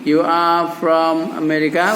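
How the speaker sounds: slow, measured question for repeating; drawn-out teaching example; slow model phrase. Shown as a demonstration. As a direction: say it slowly for learners to echo.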